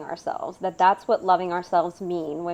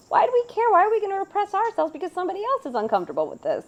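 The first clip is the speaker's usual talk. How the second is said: exasperated outburst; 10 semitones higher, faster than usual. Shown as a demonstration. Co-host railing against repressing feelings for others, high and exasperated. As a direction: fire off the question in disbelief.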